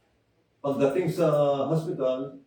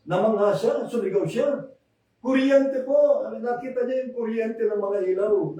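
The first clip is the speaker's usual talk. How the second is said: excited telling; 7 semitones higher than usual. Real, not acted. Preacher tells church of the healing, excited. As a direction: build up the wonder of the miracle. Telling amazed woman shouting.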